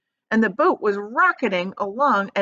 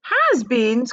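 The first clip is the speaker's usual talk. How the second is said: louder puzzled cry; Violet cries; 4 semitones higher, 6 dB louder than usual. A narrator's reading. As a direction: cry it back offended. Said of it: "cried Violet Beauregarde".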